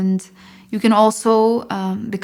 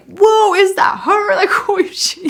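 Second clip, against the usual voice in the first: astonished; 11 semitones higher, 5 dB louder than usual. Retold or imitated. Hearing her own singing.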